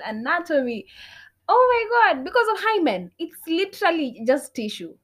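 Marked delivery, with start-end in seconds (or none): 1.5-5.0 high, loud exasperation; exasperated outburst. Real, not acted. Ranting to listeners about virginity myths, exasperated. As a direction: burst out, pitch jumping up in exasperation.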